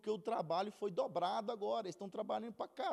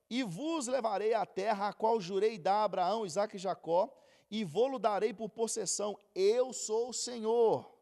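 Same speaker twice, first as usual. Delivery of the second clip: scripture reading; 8 dB louder than usual. Acted. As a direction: proclaim the verse to the congregation. Reads scripture loudly. (leitura bíblica proclamada)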